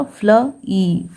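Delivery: "फ्ल ई" slowly, two separate sounds; sounded out. Shown as a demonstration. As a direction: say "फ्ल", then a long "ई". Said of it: "double ई को एक साथ ई पढ़ा जाता है". ("फ्ल" और लंबी "ई" अलग-अलग)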